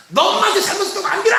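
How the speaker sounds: mock parent telling a child off; scolding parent. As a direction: snap at the child like a cross mother.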